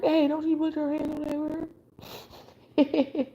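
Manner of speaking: high, drawn-out country holler at normal volume; strong country accent. Acted. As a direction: play a country neighbor stepping in, high and slow. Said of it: "Somebody got a strong country accent".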